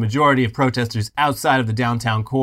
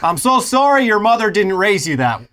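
sugary polite Canadian scolding, higher and louder; 5 semitones higher, 3 dB louder than usual. Retold or imitated. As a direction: apologise sweetly while insulting them. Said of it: "Very polite".

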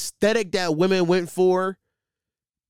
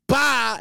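mock angry outburst; 9 semitones higher, 4 dB louder than usual. Drunk woman hangs up angrily.

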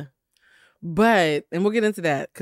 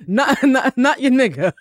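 laughing; 5 semitones higher, 7 dB louder than usual. Real, not acted. Laughing at own joke.

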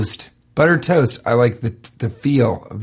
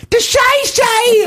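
strained, drawn-out falsetto yell; falsetto attempt; 3 dB louder, much slower than usual. Shown as a demonstration. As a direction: push it out very high and slow. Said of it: "can't do this falsetto thing".

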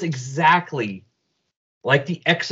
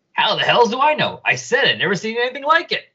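high-pitched, indignant doctor; exasperated retort; 8 semitones higher than usual. Acted. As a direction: protest it, pitch climbing in exasperation. Doctor snaps at being asked.

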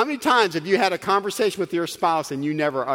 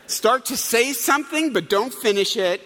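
normal talking voice

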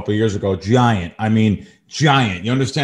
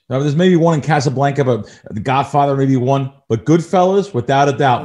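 ordinary talk, nothing marked

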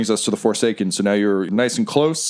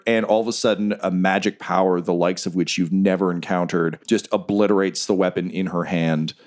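ordinary talk

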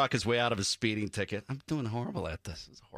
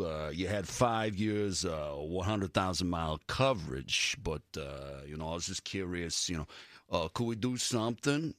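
low, mumbled, halting tough-guy impression; Sylvester Stallone; 8 semitones lower than usual. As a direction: slur it low with lots of hesitations. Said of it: "That's Sylvester Stallone".

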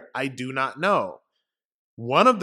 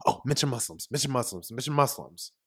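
hushed mock whisper, much softer; imitated whisper; 2 semitones lower, 5 dB quieter than usual. Pelosi whispering at Chuck Schumer in a retold video, imitated whisper. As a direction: mimic a furtive whisper, repeating under your breath. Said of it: "She's whispering at Chuck Schumer".

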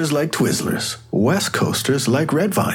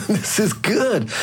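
laughing; 9 semitones higher, slower than usual. Acted. Examiner delighted by case talk.

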